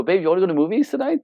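acted-out husband asking wife out; eager husband. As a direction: pitch it up, brisk and upbeat to a partner.

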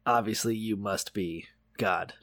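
slow, low, soft pondering; dawning realization. Acted. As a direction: work it out slowly under your breath. Acting Eli slowly working it out.

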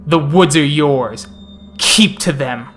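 threatening vampire prince. Acted. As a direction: proclaim the threat from afar.

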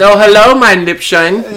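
high, loud, slow hammed-up German accent; campy German accent, drawn out on mein Liebchen; over the top German accent. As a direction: ham up a cartoon German villain accent. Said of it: "Over the top German accent".

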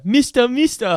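put-on high, loud child calling; kid calling. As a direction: call out like a little kid. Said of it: "I thought you meant the".

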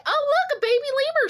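very high excited squeal of delight; freaking out. Retold or imitated. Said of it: "I was freaking out".

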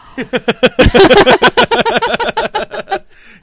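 long, very high, loud laughing fit; helpless shrieks of laughter; real laugh. Real, not acted. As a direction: crack up completely, high and loud. Laughing at drive-by clip.

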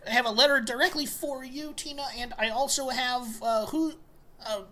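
put-on high eager kid voice; bright little delivery boy; young elf kid. Plays young elf Dipley.

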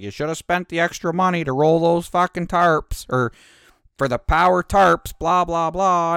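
mock-nagging trucker; lecturing old trucker. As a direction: imitate the old man's know-it-all lecture.

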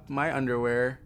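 ordinary delivery

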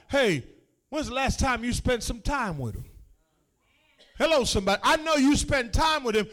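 ordinary delivery

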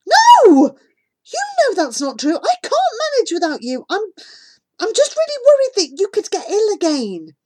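high, loud, pleading denial; reassuring a hurt friend, high and urgent; desperate protest. A narrator's reading. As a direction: cry out no, then plead.

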